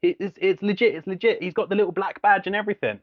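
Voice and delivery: high, insistent protest; insistent friend. Retold or imitated. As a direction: insist it's real. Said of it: "And he's like".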